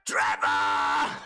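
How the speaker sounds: loud low call of the name "Trevor!"; shouting a name. Real, not acted. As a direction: belt out "Trevor!" like calling across an arena. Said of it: "Such a great name to shout out".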